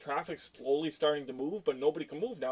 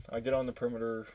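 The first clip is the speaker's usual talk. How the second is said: ordinary talk, nothing marked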